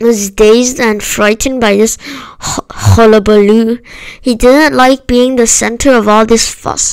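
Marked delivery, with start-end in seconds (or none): none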